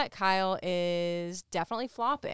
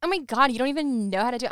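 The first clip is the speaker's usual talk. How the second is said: mocking Bonnie; 6 semitones higher, 7 dB louder, much faster than usual. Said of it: "and you're like".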